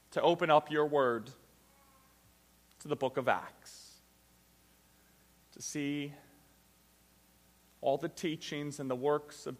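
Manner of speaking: low, soft, measured praying voice; hushed, reverent address to God; pastoral prayer. Real, not acted. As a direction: pray humbly and quietly, pausing between phrases. Leads the congregation in prayer.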